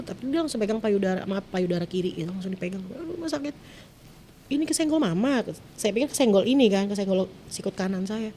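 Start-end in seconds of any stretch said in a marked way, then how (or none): 2.8-5.4 high, plaintive child voice, imitated; pitched-up pained whine. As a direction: re-enact a hurting child complaining to mum. Child in pain complains to mother.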